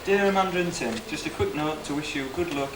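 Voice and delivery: normal talking voice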